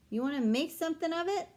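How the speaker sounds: put-on a six-year-old puffing up to sound tough; tough guy voice. A narrator's reading. Little boy daring the girl, in a hammed-up tough-guy voice. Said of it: "in his best tough guy voice".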